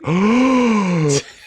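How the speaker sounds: sharp high gasp of delight; excited gasp. Retold or imitated. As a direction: gasp in wide-eyed wonder. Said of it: "And Evan's like".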